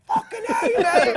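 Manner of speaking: very high, loud cheerful yell; excited shout. Retold or imitated. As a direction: shout it like a star waving from a car. Imitating the star shouting goodbye.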